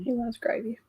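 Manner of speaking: unvoiced whispered aside; whispering. Real, not acted. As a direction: whisper it like a secret. Whispered aside about husband.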